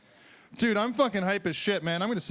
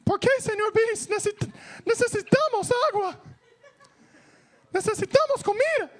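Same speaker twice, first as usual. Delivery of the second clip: put-on pleading kids. Kids begging Mister Beast for food, high child voice. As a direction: beg like a hungry little kid. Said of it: "Kids behind him like".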